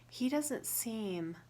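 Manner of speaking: ordinary delivery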